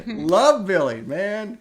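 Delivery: cracking up with delight; laughing. Real, not acted. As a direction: burst of admiring laughter.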